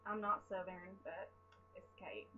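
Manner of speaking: put-on Southern twang; Southern accent. Vlogger greets viewers as a joke, mock Southern accent. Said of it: "talking in a Southern accent".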